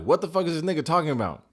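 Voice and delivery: loud, high, fast incredulous outburst; mock bewilderment. Recapper voices her confusion at his talk, mock bewilderment.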